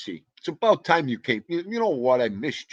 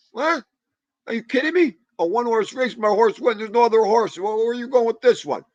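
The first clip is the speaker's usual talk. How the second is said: retold disbelief; 8 semitones higher, 4 dB louder than usual. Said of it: "I said".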